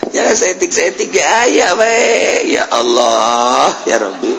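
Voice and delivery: tearful cry. Real, not acted. Preacher overcome while recalling.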